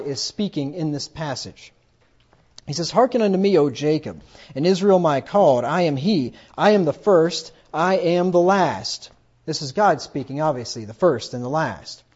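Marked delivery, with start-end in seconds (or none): 2.9-9.1 louder, solemn reading of God's words; scripture reading. Acted. Preacher reading God's words aloud to the congregation, louder and solemn. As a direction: declaim the verse like the voice of God.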